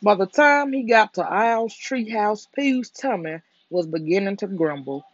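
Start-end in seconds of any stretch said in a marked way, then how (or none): none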